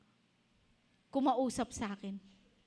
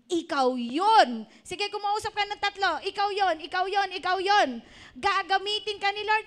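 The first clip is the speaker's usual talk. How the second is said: loud, high preacher's rally; raised-voice urging, pitched well up; rousing exhortation; 7 semitones higher, 7 dB louder than usual. Real, not acted. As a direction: fire up the congregation, punching it out loudly. Rallying congregation to address neighbours.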